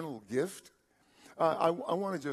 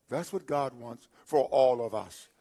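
ordinary talk

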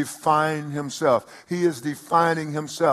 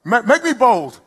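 pleading prayer; 5 semitones higher, 7 dB louder than usual. Retold or imitated. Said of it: "I said".

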